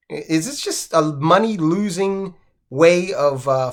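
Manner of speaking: normal talking voice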